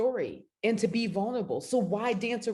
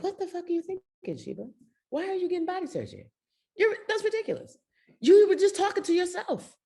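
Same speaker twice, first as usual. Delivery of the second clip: high, mock-scolding inner voice, pitched up; scolding herself; 12 semitones higher, faster than usual. Scolding herself over body surgery, pitched high. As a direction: tell herself off in a shrill high voice. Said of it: "I was like".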